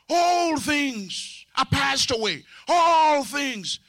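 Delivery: high, drawn-out quotation; proclaiming scripture. Retold or imitated. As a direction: stretch "All" long like a preacher. Mimics believers proclaiming scripture.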